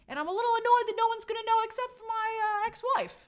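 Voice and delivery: high, put-on voice of irked Danny; playful peeved impression; mock annoyed Danny. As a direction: complain in a hammed-up character voice. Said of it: "She'd be like".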